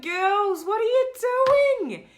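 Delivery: can't believe what she's watching; exasperated disbelief. Retold or imitated. Telling viewers how she reacted to the girls. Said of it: "And I'm thinking".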